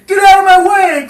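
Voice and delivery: ordinary talk, nothing marked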